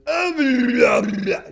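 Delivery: loud, high mock shout of gibberish; yelling nonsense. Yelling gibberish at a stranger, loud mock shout. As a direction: bellow nonsense at him. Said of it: "I just yelled at him".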